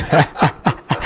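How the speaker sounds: real laughing at a guest's remark; amused laugh. Real, not acted. Host laughing at guest's hint about salary, amused laugh.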